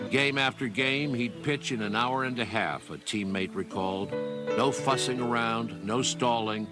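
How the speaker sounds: ordinary delivery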